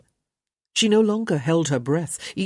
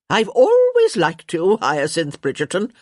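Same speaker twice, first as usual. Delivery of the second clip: crotchety countess; 2 semitones higher, 6 dB louder than usual. A narrator's reading. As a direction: proclaim it bluntly, leaning hard on "always". Countess declares her liking.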